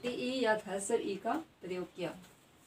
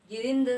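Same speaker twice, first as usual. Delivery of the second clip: गिरिंद punched louder on the short "rin"; teacher's forceful demo of गिरिंद; stressed syllable; 6 dB louder than usual. Shown as a demonstration. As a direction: hit the middle of गिरिंद hard. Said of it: "उच्चारण करते समय जोर".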